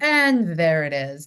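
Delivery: loud, drawn-out "there it is"; mock-grand reveal. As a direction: announce it slowly and loudly, as a long-awaited moment. Acts out reaction to set reveal.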